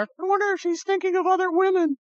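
high-pitched mock girl voice; comic falsetto-like girlfriend's inner thought; fretting girlfriend. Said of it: "that thing of the girl sitting there".

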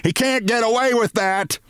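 high-pitched outraged scream; screamed accusation. A narrator's reading. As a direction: scream it in shrieking disbelief. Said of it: "she screamed".

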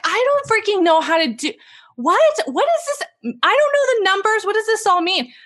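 high, fast panicked rant. Retold or imitated. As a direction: spiral into panic, voice way up. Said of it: "And I'm like".

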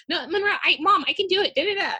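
put-on high, tiny child voice; little kid voice. Mom mimicking her son's little voice, high and tiny. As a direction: pipe it up like a small child insisting. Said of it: "That's the voice".

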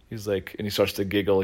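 normal talking voice

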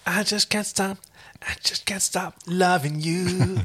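high breathy lovesick whisper; breathy longing whisper. Pretend. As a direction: sigh it like a lovesick cowboy. Brokeback parody, breathy whisper.

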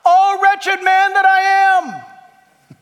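high, slow, loud wail; Paul the Apostle crying out his wretchedness, in a slow loud wail; anguished cry of Paul. Retold or imitated. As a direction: cry it out from the depths of misery. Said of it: "Hey, Paul, what do you got to say for yourself?".

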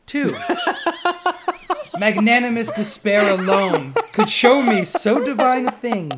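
reading the verse through laughter; poem reading. Acted. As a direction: recite the poem, giggling.

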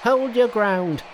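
high-pitched battle challenge; high, bold monkey voice, squaring up to robbers; Monkey's cry. A narrator's reading. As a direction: call it out high and defiant. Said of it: "and cried".

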